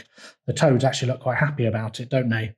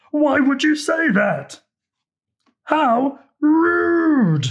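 very high, louder wailing huff; tantrum; 13 semitones higher, 3 dB louder, much slower than usual. A narrator's reading. Alligator sulking at the insult, high wailing tantrum. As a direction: whine it like a sulking toddler.

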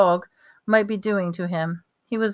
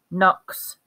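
ordinary delivery

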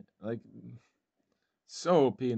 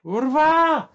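much higher, much louder angry swear; furious shout; 12 dB louder than usual. Real, not acted. Gamer yelling at being focused down, furious shout. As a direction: blast it out in rage.

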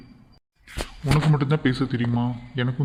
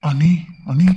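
louder urgent calling of her name; 3 semitones higher, 7 dB louder, much slower than usual. Acted. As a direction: call her back, frightened. Husband calling fainting wife. (பயத்துடன் உரக்க பெயரைக் கூப்பிடுதல்)

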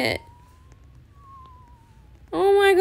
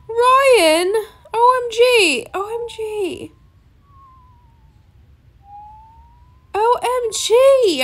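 close to tears; 6 semitones higher, 3 dB louder, slower than usual. Real, not acted. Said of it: "I'ma cry".

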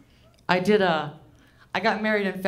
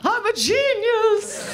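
mock triumph; 13 semitones higher, 3 dB louder, slower than usual. Stoned self-praise over toothbrush.

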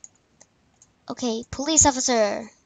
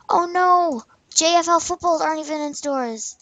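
dismayed outburst; 5 semitones higher, 7 dB louder than usual. Real, not acted. Dismay at missing footballs.

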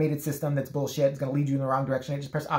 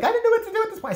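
whiny high mock friend; 5 dB louder, faster than usual. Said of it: "He's just like".

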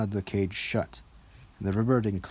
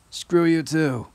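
shouted jeer; calling out; 8 semitones higher, 8 dB louder, slower than usual. Acted. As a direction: yell it after them. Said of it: "Ronan called after them".

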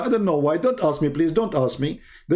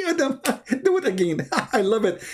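higher, giggling delighted voice; laughing god; 7 semitones higher, faster than usual. Pretend. Mocking God tickled by Adam's sneeze, laughing. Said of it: "Allah, he should be ticklish now".

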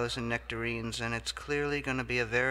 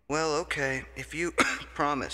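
1 coughing; 4 semitones higher, 4 dB louder than usual. Acted. Gives in, coughing.

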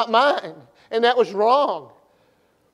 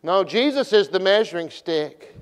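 normal talking voice

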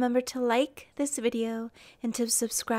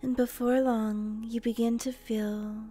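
guided meditation; 4 dB quieter than usual. Acted.

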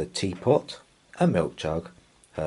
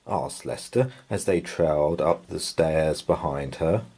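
ordinary delivery, nothing marked

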